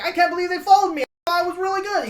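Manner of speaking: loud, high frantic yell; constantly yelling pawn. Acted. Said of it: "just constantly yelling".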